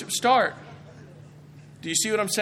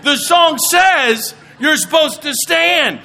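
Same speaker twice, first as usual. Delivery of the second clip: higher, louder, slow hammed-up scolding; mock scolding shout; 7 semitones higher, 7 dB louder, slower than usual. Congregation scolds a newcomer for sitting, in a mock shout. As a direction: shout it like an outraged churchgoer.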